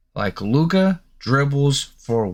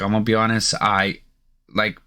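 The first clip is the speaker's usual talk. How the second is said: ordinary talk, nothing marked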